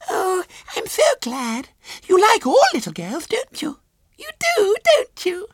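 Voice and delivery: put-on very high childish squeal; tearfully glad little girl. A narrator's reading. Small girl cheers up and coaxes William, high squeal. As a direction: coax brightly through drying tears. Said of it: "A smile broke through the tear-stained face".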